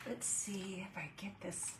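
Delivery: breathy whisper to herself. Real, not acted. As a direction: whisper it under your breath. Adjusting the light, muttering to self.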